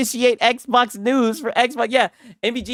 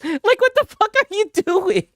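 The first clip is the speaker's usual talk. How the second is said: laughing disbelief; 13 semitones higher than usual. Real, not acted.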